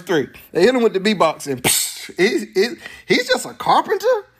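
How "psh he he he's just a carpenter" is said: higher, incredulous sneer on just; mock scoffing. Townspeople sneering at Jesus, a mock scoffing question. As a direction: scoff it like he's nobody.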